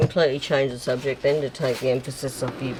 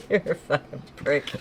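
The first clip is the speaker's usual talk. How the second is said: laughing. Real, not acted. Laughing insult between friends.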